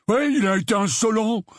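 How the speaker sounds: high, loutish protest; defensive Dudley. Acted. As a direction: justify yourself like an irritated bully. Dudley defends himself angrily. (protestation de petite brute, voix aiguë)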